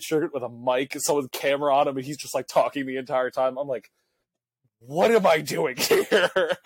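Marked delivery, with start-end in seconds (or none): 4.8-6.6 slow, laughing through the question; mock bewilderment, laughing it out slowly; laughing disbelief. Retold self, baffled on field.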